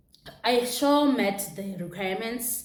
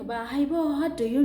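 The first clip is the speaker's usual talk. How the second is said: tearful plea; 7 semitones higher, 4 dB louder than usual. Retold or imitated. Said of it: "I was still crying".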